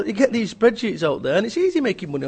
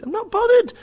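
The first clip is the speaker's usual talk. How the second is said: very high, loud, fed-up dismissal; exasperated dismissal; 5 dB louder than usual. Real, not acted. Speaker rejecting bragging about big deals, high exasperation. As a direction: cut the boasting down, pitched right up.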